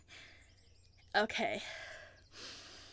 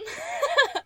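gleeful laugh; 8 dB louder than usual. Acted. Mocking laugh during chase.

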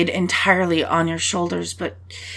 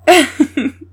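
short bright laugh, higher; 7 semitones higher, 5 dB louder than usual. Real, not acted. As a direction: laugh at your own joke. Laughs at own joke.

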